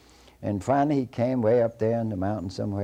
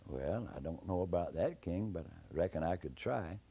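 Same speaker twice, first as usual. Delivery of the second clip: soft, doubtful answer; a quiet, reluctant agreement; hesitant reply; 3 semitones lower, 10 dB quieter than usual. Acted. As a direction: answer low-volume and unsure. Jack unsure about killing giants.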